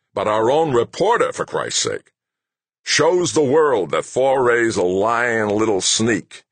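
high, slowed, heated complaint; exasperated. A narrator's reading. Boss asking staff why nobody thinks, exasperated. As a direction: drive each point home, incensed.

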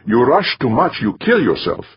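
put-on Middle European accent. A narrator's reading. Safety officer announcing herself to a colleague, Middle European accent. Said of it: "in her Middle European accent".

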